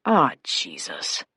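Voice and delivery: low, soft, slow groan to himself; inward thought. A narrator's reading. Cop spots trouble in the store mirror, muttered inward dismay. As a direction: drop it low and under the breath. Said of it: "he thought".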